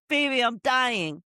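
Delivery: mock melodramatic deathbed plea; mock dying girlfriend. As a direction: overplay a dying sweetheart.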